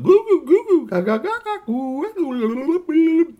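loud, very high gurgling goo-ga; baby babble. Acted. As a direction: imitate a baby trying to talk. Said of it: "And the baby went".

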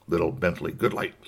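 usual speaking voice

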